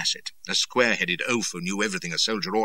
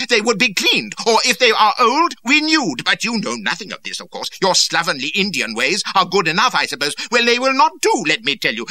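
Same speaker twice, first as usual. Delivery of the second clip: high, loud raging dressing-down; furious tirade; 8 dB louder than usual. A narrator's reading. Cardigan berates Reynolds about his slovenly Indian ways. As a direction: lay into him without pause. Lord rages on at captain.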